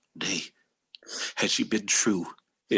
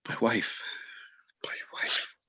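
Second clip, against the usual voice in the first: anguished whisper; 3 semitones higher, 4 dB quieter than usual. Acted. Othello in anguish.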